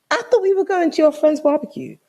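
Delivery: high, incredulous challenge; indignant confrontation. Retold or imitated. Girlfriend learns whose barbecue it is, indignant. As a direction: question him with your voice pitched right up. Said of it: "This is me".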